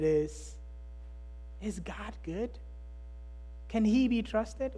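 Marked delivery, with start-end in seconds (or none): 1.6-4.7 hushed question. Shown as a demonstration. Pastor repeating the question softly as if in a hospital room. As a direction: let it drop to a private, quiet plea.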